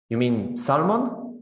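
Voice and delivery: salmon slowly, with the l sounded; non-standard pronunciation. Acted. Confused listener checking the word, non-standard salmon with an l. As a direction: ask it slowly, pronouncing the l in "salmon". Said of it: "an incorrect way of pronouncing things".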